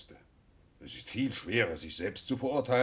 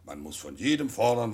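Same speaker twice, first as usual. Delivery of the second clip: ordinary delivery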